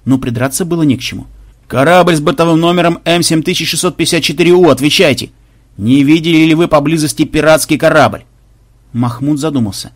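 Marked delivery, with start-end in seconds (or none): 1.7-8.2 droning monotone announcer voice; official hail. Acted. Flagship questioning a ship about pirates, monotone booming hail. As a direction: intone it evenly like an automated broadcast. Флагман снова вызывает корабль.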